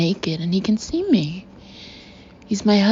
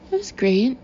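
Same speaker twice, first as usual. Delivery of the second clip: bright, higher cheerful tone; forced cheerful; 5 semitones higher than usual. A narrator's reading. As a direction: put on a brave cheerful face. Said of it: "Somehow I manage a cheerful tone".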